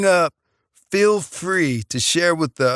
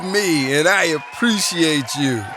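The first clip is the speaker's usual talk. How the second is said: tabloid tv host; 4 semitones higher than usual. Acted. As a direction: hype up the studio crowd. Opens his own talk show.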